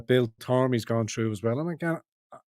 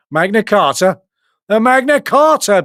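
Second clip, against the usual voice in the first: mock rallying cry; 9 semitones higher, 10 dB louder than usual. Streamer hails the Magna Carta.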